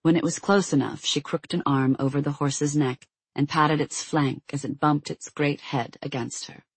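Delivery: ordinary delivery, nothing marked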